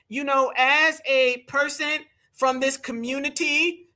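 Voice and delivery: slow, drawn-out mocking quote; Kamala Harris. Commentator mockingly quotes her to his viewers, slow, high imitation. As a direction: imitate a politician, stretched out slowly and pitched high. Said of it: "that's what she said".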